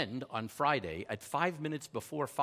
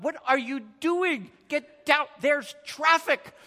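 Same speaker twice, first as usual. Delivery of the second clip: much higher and louder, a frantic hiss at himself; panicked inner scolding; 14 semitones higher, 10 dB louder, slower than usual. Retold or imitated. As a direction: yell at your own hand in mock panic, slowing on the last words. Scolding his own raised hand.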